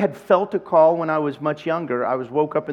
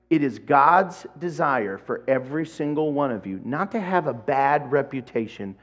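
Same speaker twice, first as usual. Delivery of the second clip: usual speaking voice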